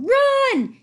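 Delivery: loud, high urgent yell; urgent yell to flee. A narrator's reading. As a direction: yell it to get him moving.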